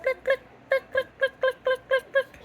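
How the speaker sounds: high clucking like a hen; chicken cluck. Shown as a demonstration. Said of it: "it's a chicken".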